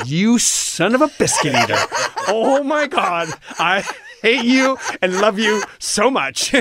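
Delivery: fond outrage through laughter; laughing exasperation. Retold or imitated. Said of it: "inside, I was going".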